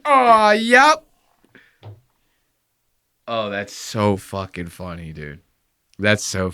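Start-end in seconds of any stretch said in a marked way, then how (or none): 0.0-1.0 high, loud drawn-out catchphrase; mock flying call. Host imagining the man in flight, high calling mockery. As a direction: turn the catchphrase into a bird-like call. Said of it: "flying through the air going".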